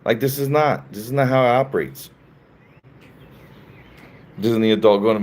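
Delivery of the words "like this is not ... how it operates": ordinary delivery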